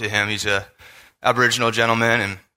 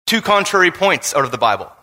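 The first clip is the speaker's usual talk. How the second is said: ordinary delivery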